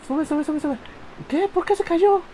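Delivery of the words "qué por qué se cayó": high, loud disbelief; shocked outrage. Real, not acted. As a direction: cry out in disbelief. Character suddenly falls.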